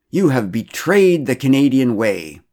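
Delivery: loud, high, slow, with "betrayed" stressed; furious accusation. A narrator's reading. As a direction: condemn him and storm off. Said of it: "he declared".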